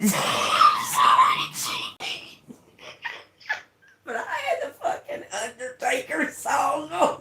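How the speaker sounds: words collapsing into breathless laughter; real high, helpless laughing speech. Real, not acted. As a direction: try to talk while cracking up. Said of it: "cannot help but just sit here and laugh".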